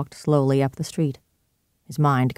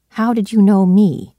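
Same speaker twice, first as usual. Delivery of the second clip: louder, higher demand; insistent; 6 semitones higher, 7 dB louder than usual. Acted. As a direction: press him for an answer. Hyde presses the question again.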